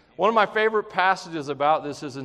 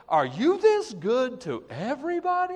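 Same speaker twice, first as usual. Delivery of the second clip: higher, bright exclaiming wonder at his goodness; joyful wonder; 9 semitones higher than usual. Shown as a demonstration. Preacher showing the congregation how to start their day, joyful wonder. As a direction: say it like a delighted morning greeting to God. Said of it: "Start your day going like this".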